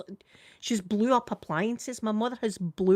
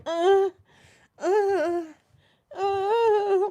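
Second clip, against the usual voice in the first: put-on high, crying toddler whimper, much higher; imitating grandson's whimper; 11 semitones higher, 3 dB louder, much slower than usual. Grandma imitating grandson faking a trapped foot, whimpering. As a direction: mimic a child faking pain. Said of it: "like that".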